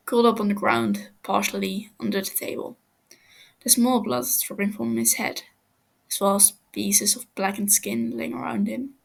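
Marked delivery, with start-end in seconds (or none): none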